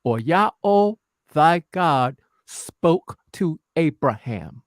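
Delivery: very slow, deliberate scripture reading, each word weighed; slow solemn reading. Shown as a demonstration. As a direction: read it out slowly so every word lands, "thy God spoke to Abraham". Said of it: "I need you to listen real close".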